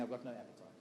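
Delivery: very soft, lifeless reply; drained whisper-soft answer. Retold or imitated. Depressed patient answering the doctor, very soft and lifeless. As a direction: barely voice it, worn out.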